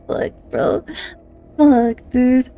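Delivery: ordinary talk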